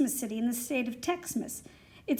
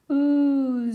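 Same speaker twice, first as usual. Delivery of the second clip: crowd's awed ooh; 5 dB louder than usual. A narrator's reading. Crowd in awe.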